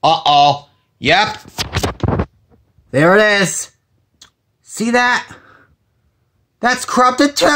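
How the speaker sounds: thrilled at the discovery; excited. Real, not acted. Finds corrupted street view.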